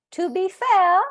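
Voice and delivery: high, loud British interjection; emphatic British "fair", r dropped; British accent. Retold or imitated. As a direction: put on a British guest's accent. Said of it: "I just do it with the accent".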